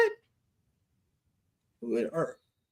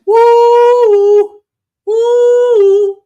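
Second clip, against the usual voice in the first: high, loud whoop; excited whoop; 13 semitones higher, 8 dB louder, much slower than usual. Real, not acted. Preacher whoops at the hot scripture. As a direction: holler with joy.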